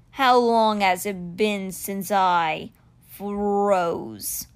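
slow, drawn-out creature voice; stone cheetah, questioning. Acted. Stone cheetah asks the boy about lost time, slow voice. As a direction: stretch the question out ponderously.

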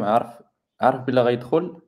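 usual speaking voice

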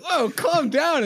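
high, loud, slow mock alarm; alarmed calming. Kids calming the man who smashed the bottle, alarmed loud plea. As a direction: plead with him to calm down, loudly.

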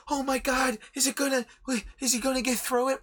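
high, breathless mock fan gasping over a fight; mock hyped fan. Reviewer mocking shonen fans, in a high mock-excited voice. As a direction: ham up a nervous anime fan on the edge of his seat.

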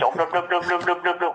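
high run of babble; mocking gibberish. Listener drowns out the caller's demo, high mocking babble.

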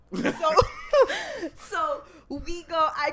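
laughing through the words. Real, not acted. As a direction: giggle while telling it. Laughing while retelling.

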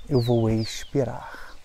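normal talking voice

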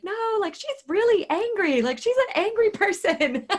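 high, squeaky mock protest; nervous friend. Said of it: "And my friend was like".

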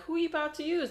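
hammed-up high query; mock high question. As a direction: ask it up high and teasing. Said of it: "And they're like".

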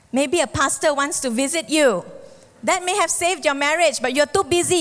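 ordinary delivery

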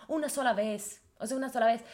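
normal talking voice

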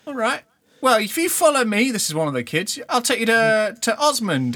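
high, loud eager urchin; eager to lead; street kid voice. Acted.

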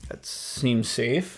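dry sarcasm. Real, not acted. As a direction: drag it out, bone dry. Sarcastic reply to dangerous fire story.

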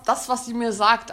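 ordinary talk, nothing marked

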